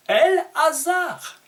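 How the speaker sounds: "El-Hazar" high, loud and slow, in two parts; Hebrew form El-Hazar. Shown as a demonstration. Teacher revealing Éléazar's real name, "El-Hazar" loud, high and drawn out. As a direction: proclaim "El", then stretch out "Hazar". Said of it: "il s'appelle".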